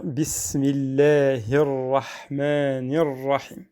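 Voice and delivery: bismillahir rahmanir rahim recited slowly with a light L after the i. Shown as a demonstration. Teacher modelling the rule for students, slow light bismillahir. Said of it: "donc on va dire".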